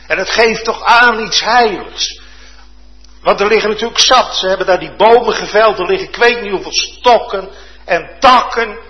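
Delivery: loud, lively fervent preaching; full-force preaching. Acted. Preacher telling Elisha's axe miracle to the congregation, loud and fervent. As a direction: preach it with urgency.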